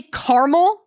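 "caramel" as KAR-mul with a rising, doubting end; mimicked two-syllable caramel. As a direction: echo "caramel" as CAR-mul, questioning. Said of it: "You say caramel?".

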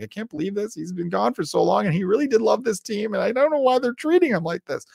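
high, pleading mock-crying widow; tearful widow impression. Host mimicking the crying widow at the ceremony, high and tearful. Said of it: "like, she's crying".

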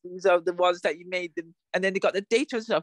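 high, hammy babbling parody; mock character voice. Host parodying a character's confusing exposition, high hammy babble. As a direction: rattle off the plot like a silly character. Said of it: "he was like".

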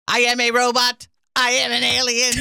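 mock robot announcement, held high and level; robot and alien bit. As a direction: imitate a loud robot on one held pitch. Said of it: "doing different bits and voices with us".

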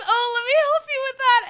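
sleazy guy voice. Retold or imitated. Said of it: "he goes".

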